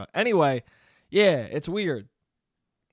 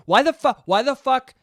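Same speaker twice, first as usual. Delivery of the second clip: raised-pitch entitled demand, mock outrage; Karen-y complaining; 3 semitones higher than usual. Karen demanding the men in reflective vests, Karen-y complaining. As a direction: play an indignant Karen demanding answers. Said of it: "I was getting real fucking Karen-y".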